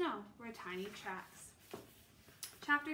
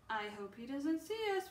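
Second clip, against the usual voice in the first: usual speaking voice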